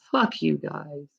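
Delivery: real weary contempt; bitter mutter. Real, not acted. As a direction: throw it away under the breath. Bitter aside after the rant.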